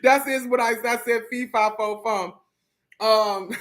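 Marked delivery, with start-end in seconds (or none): 3.0-3.6 real laughter breaking through; helpless giggles; laughing. Real, not acted. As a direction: crack up at yourself. Bursts out laughing.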